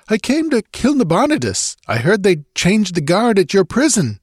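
high-pitched, urgent nobleman voice; agitated young nobleman. A narrator's reading. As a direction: pitch it high, anxious to explain.

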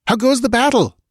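high, urgent demand; crying out. A narrator's reading. Queen demands battle news from her priest, crying out. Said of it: "she cried".

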